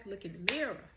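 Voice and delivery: usual speaking voice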